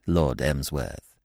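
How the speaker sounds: low, soft, unruffled; quiet and calm. Acted. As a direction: stay perfectly composed. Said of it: "quietly equal to the crisis".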